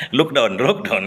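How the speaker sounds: normal talking voice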